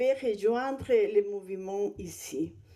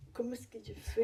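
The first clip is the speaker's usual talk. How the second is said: real whisper to herself, unsure; quick whispered aside to the organiser; 8 dB quieter, much faster than usual. Real, not acted. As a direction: whisper the question under her breath. Asks host how to start slides.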